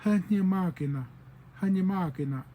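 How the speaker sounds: "Hanye Magina" with "ha" before "nye"; third dialect form. Shown as a demonstration. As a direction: open "Hanye Magina" on a breath of h. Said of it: "from another dialect would say".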